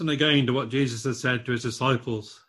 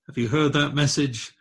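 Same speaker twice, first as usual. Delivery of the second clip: ordinary talk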